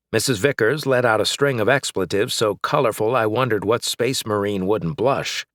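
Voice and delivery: ordinary delivery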